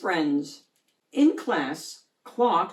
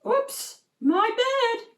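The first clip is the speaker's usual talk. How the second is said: sheepish squid; slower than usual. Acted. Giant squid apologises.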